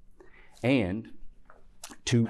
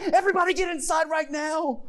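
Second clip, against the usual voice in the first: high, loud panicked yell; hammed-up mom shouting the kids to safety; frightened mother; 5 dB louder than usual. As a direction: shriek the order in mock alarm. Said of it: "emotional reaction of fear on the mother's part".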